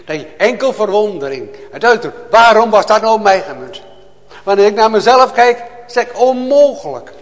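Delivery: loud, high, impassioned preaching; fiery preaching. Acted. Preacher to his congregation, a loud fervent cry. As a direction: proclaim it to the whole church with full fire. Preacher rises to full force.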